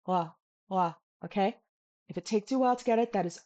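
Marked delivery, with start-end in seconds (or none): 0.1-0.9 ah sliding in through a slight w. Shown as a demonstration. Teacher offers an easier option, ah with a bit of wa. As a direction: round into the ah like wa. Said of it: "a bit of a wa is okay".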